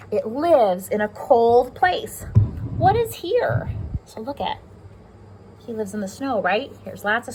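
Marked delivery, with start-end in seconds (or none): 0.1-3.7 slow, louder storybook reading; reading to children. Acted. Teacher reads the owl book aloud to her class. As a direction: read it out slowly for little ones.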